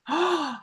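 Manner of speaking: sharp gasp of surprise; shocked gasp. Real, not acted. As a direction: gasp as if struck by the idea. Reacting to covid shot theory.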